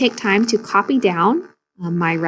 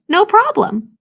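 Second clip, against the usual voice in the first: cheerful snake; 12 semitones higher than usual. Acted.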